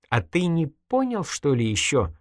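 high, slow opening, mildly indignant; exasperated question. A narrator's reading. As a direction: ask in disbelief at being misunderstood. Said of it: "возмутилась Надя".

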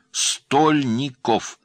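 very slow, loud "Стольников", syllable by syllable; dictating. A narrator's reading. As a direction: stretch "Стольников" out high and loud for writing down. Dictating a surname to write down.

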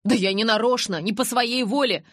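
high, loud defensive plea; flustered, insistent denial; protesting. A narrator's reading. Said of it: "упирался Джейкоб".

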